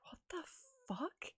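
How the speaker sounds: shocked whisper. Retold or imitated. Miria reacts to Horatio's letter in a hushed whisper. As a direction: mutter it under your breath in shock.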